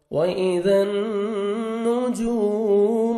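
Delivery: teacher reciting verse melodically; Quran recitation. Acted. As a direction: lift the verse into a slow sung recitation.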